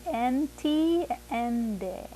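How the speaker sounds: "Entiende": en-TIEN-de, i like English E; entiende with E sound. Shown as a demonstration. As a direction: say "Entiende" with the i as a clear E. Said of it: "I sounds like an E".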